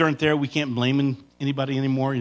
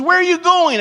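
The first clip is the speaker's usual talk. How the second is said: suspicious wife; 14 semitones higher, 5 dB louder than usual. Retold or imitated. Wife calls to husband.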